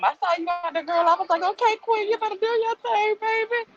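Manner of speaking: put-on high, excited hype-girl cheer; squealing "you better do your thing, baby"; hyped cheer. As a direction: cheer her on like a proud friend. Cheering a commenter on.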